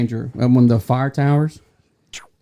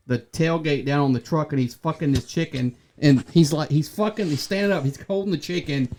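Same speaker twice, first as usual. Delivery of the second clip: usual speaking voice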